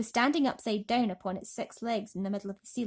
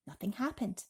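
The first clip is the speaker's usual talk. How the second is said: usual speaking voice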